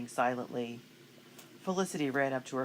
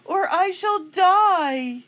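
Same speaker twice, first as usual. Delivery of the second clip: high, slow, dramatic mock lover; loud lovesick wail to a wig; mock swoon; 12 dB louder, slower than usual. Mock romantic kiss to wig head.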